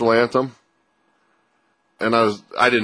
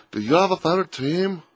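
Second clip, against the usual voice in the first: put-on failed Belgrade accent; 7 semitones higher, 3 dB quieter than usual. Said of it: "I can't do a Belgrade accent".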